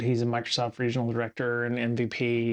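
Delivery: ordinary talk, nothing marked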